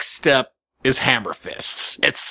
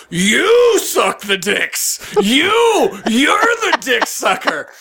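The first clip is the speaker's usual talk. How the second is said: slow, high-pitched mock outrage; pissed-off heckler; 9 semitones higher, much slower than usual. Heckler jumps from his seat at the comedian, mock outrage. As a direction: stretch out each indignant "you". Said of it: "this dude got pissed".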